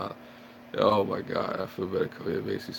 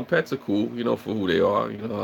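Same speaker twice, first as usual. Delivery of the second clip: usual speaking voice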